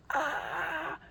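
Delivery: gasp, then high "ah"; retold pained cry. Patient reacting to the test, gasping cry.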